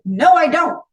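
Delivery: No, I don't pushed out hard and loud; emphatic denial. Retold or imitated.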